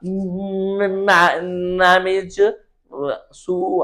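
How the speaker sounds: drawn-out म stuck before मैनेज finally comes out; halting, stuttered म before मैनेज, then a broken सु; demonstrating speech impediment. Shown as a demonstration. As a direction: get caught on the first sound of मैनेज. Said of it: "ये करते हैं ना जी".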